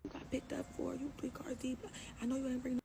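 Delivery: high-pitched agitated mimicry; imitated ex tripping. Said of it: "he get out the car tripping".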